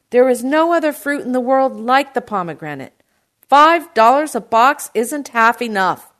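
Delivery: loud, high, impassioned shout. A narrator's reading. Uncle defends pomegranates to produce man, shouting. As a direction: champion the fruit at full volume. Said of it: "my uncle shouted".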